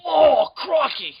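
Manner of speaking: slow, high, shouted Aussie exclamation; hammed-up Australian. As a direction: bellow it like a croc hunter. Mock Australian impression.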